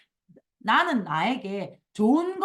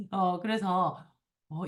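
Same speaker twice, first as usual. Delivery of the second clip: normal talking voice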